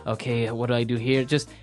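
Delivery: usual speaking voice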